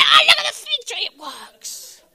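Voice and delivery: excited squeal. Acted. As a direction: squeal it in giddy delight. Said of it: "And he said".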